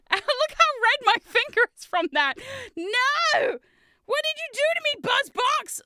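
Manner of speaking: high, loud cry of betrayal; hammed-up anguished shout; dramatic wail. Real, not acted. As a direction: wail at the box that hurt you. Mock outrage at the box.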